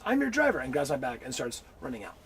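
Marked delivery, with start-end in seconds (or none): 0.0-0.6 high eager mock driver.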